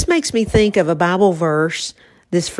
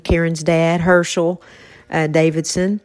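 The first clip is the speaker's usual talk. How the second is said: usual speaking voice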